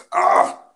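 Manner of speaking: sudden loud pained cry, muscles locking up; pained yell. Real, not acted. Podcaster straining his sore muscles, loud pained yell. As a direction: let out a sharp cry of pain. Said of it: "You okay there, buddy?".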